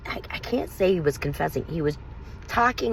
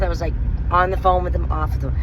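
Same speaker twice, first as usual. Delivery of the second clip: ordinary talk, nothing marked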